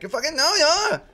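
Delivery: very high, loud squeaky insistence; shrill mock accusation, tugging at him; mock shouting family. Family pulling him from their bed, mock yelling. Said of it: "then they're like".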